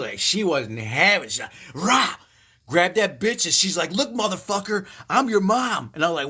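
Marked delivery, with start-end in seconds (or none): 1.7-2.2 high, loud "rah" like a lunging dog; imitated dog snarl. 3.9-5.9 high, forceful voice for a dog; tough mama dog. Retold or imitated. Voicing mother dog asserting herself.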